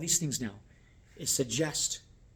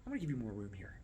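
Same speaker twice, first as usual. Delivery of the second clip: very soft, lower murmur to guests; discreet server; 4 semitones lower, 12 dB quieter, faster than usual. Shown as a demonstration. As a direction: slip it in quietly while clearing. Discreet server clearing the table.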